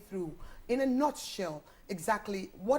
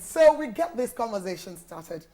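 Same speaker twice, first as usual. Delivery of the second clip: ordinary talk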